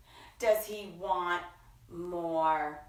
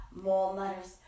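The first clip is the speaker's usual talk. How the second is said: usual speaking voice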